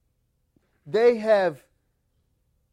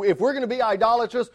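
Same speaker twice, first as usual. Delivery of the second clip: normal talking voice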